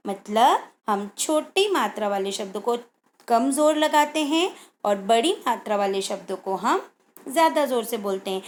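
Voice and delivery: usual speaking voice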